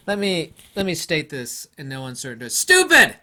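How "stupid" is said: high, loud single-word blast; emphatic outburst. Real, not acted. Vlogger rants at YouTubers about sharp lenses, loud scornful outburst. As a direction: spit out the verdict, scornful and loud. Ranting against a lens myth.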